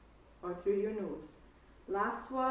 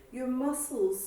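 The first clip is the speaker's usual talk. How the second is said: ordinary talk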